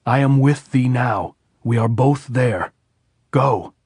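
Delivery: loud call, slowing on the middle line; shouted farewell. A narrator's reading. Robert shouts farewell to Maria as she rides off, loud and slowing. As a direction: send her off with a final loud call. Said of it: "Robert Jordan shouted".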